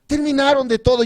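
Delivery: ordinary talk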